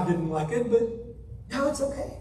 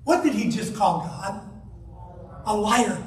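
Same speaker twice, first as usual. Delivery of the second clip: ordinary delivery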